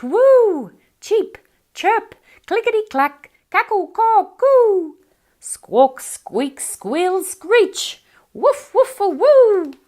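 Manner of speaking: very high, loud yelping calls; a lyrebird mimicking a dingo howl; animal calls. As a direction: yelp and screech like animals. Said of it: "burst into a serenade of sounds".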